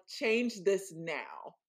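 very slow, deliberate command; slow, calm command to the sun. Retold or imitated. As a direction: drag each word out like an order. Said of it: "I literally was like".

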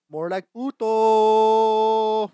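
loud, long-held final word; mocking call. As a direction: belt out the punchline, stretching the end. Mocks the jock's name, stretched out.

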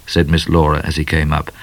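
usual speaking voice

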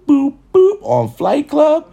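usual speaking voice

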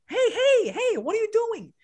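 high-pitched urgent calling out; alarmed teacher. Retold or imitated. Teacher catching two boys boxing in class, imitated high alarm. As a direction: imitate a teacher breaking something up.

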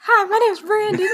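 put-on squeaky-high introduction; silly high voice. Husband introduces himself on the show, silly high voice.

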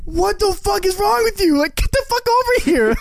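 high, drawn-out exasperated shouting; yelling parents. Retold or imitated. Imitating his parents calling him in, high exasperated yell. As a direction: holler it like a fed-up parent. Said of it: "My parents just like".

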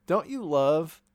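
mid-burp while talking; words pushed out around a burp. Real, not acted. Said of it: "excuse me, I keep burping".

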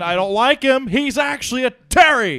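yelling as angry fans; shouting mock outrage; mock angry fan rant. Mock Celtics fans ranting.

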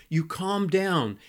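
ordinary talk

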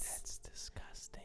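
hushed disgusted whisper. Real, not acted. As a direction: whisper it, grossed out.

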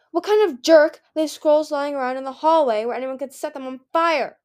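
loud, high indignant outburst; angry demand. A narrator's reading. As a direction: demand to know who is to blame. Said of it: "Peril demanded".

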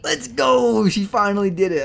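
loud, high cheer. Real, not acted. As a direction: celebrate her win. Cheering cohost's first intro.

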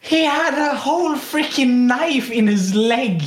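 high-pitched, incredulous outburst; amazed exclamation. Real, not acted. Friend marvelling at the teacher's knife injury, incredulous.

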